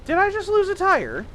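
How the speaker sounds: sudden high alarmed question; shocked surprise. Real, not acted. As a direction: caught off guard, voice jumps up. Car suddenly loses a tire.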